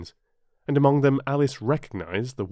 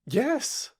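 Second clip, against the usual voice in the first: Alice's shout. A narrator's reading. Alice answering the Queen's question, high mild shout. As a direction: call it out high, with moderate force. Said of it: "shouted Alice".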